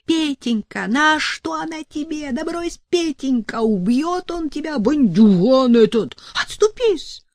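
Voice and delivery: keen over her son; lamenting wail. A narrator's reading. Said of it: "запричитала маманя".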